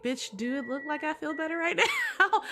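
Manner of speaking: voicing the sad friend, a touch of attitude through a laugh; mock sassy retort. Retelling a grieving character's snappy comeback, laughing. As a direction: sass him back, half laughing. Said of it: "He's like".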